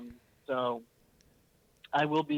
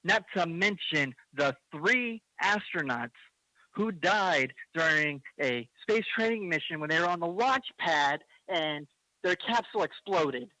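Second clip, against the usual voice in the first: worked up; 3 semitones higher than usual. Real, not acted. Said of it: "I apologize for getting a little worked up".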